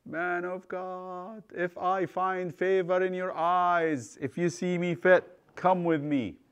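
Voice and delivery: high, slow, drawn-out plea; acted pleading, pitched high and stretched out; humble begging. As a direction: beg as if on your knees. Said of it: "The man begged him on his knees".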